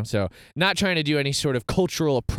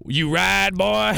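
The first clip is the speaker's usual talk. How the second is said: put-on loud, higher country-accented challenge; thick country accent; 5 semitones higher, 3 dB louder, slower than usual. Bar owner challenging a man in a cowboy hat, thick country accent. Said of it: "This guy has a thick country accent".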